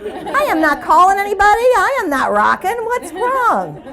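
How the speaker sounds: high-pitched, hammed-up protest; mock exasperation. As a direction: wail in comic frustration. Mock outburst at caller's success.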